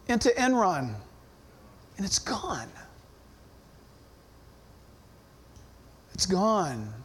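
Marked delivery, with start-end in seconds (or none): 2.0-6.9 low, near-whispered statement of loss; hushed sorrow. Real, not acted. Pastor tells of retirement savings lost to Enron, hushed. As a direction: let the voice drop away almost to nothing.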